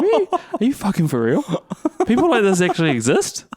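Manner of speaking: half-laughing, mock-appalled disbelief; retold disbelief. Storyteller recalling a reply to a rich woman, incredulous.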